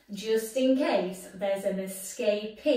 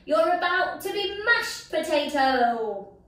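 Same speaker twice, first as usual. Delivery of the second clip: gloating villain pea; 7 semitones higher, 5 dB louder than usual. Acted.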